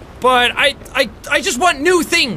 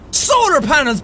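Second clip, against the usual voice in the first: ordinary delivery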